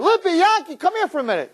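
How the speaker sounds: high-pitched call to friends. Acted. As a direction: summon two pals from another room. Calls friends from the kitchen.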